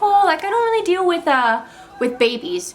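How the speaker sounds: a mock, sheepish doctor voice; imitating the doctor. Quoting the family doctor who doesn't do babies, voiced high.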